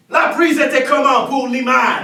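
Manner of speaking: loud set call; shouted question. Acted. Director calling across set.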